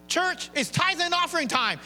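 announcing offering time to the church; pastor's call. Retold or imitated. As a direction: call out to the congregation. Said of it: "'Cause I'll say".

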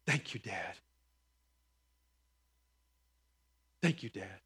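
a hushed thank you to his father; heartfelt. Real, not acted. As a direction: speak it gently to your late dad. Thanking late father, moved.

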